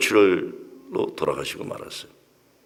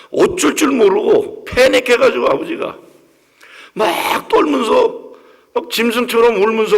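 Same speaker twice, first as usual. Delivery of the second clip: panicked sobbing; 12 semitones higher, 6 dB louder than usual. Retold or imitated. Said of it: "짐승 울음소리를 내면서".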